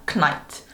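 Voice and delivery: "knight" with a hard K before the N; old pronunciation of "knight", K pronounced; sounded K. Shown as a demonstration. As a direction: say "knight" as k-nite, both consonants heard. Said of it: "과거에는 이 K가 발음이 됐었었다고".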